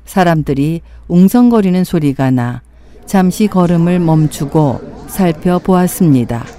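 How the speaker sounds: usual speaking voice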